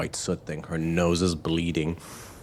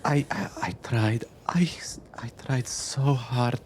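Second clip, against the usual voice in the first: breaking, half-whispered stammer, slightly raised; tearful apology; 6 semitones higher than usual. Acted. Game master as survivor confessing failure to the daughter, tearful. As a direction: stammer it out through welling tears. Survivor voiced, eyes welling up.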